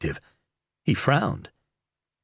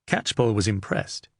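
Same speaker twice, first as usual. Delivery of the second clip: plain reading voice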